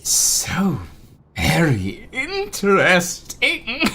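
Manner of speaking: high, drawn-out creature voice; delighted imp. Acted. Imp takes an interest in the visitor, creepily delighted. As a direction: savour each word slowly as an imp.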